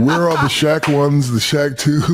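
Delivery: high, indignant disbelief with a laugh; jealous outrage. Retold or imitated. Retelling finding no Shaq shoes, jealous high outrage. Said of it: "So now I'm jealous and I'm pissed".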